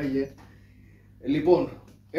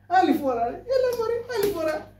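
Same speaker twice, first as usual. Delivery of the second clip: pleading yelp; 12 semitones higher than usual. Acted. Being beaten, begging to stop.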